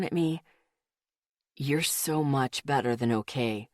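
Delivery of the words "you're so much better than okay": hushed and moved. A narrator's reading. Male friend voiced low.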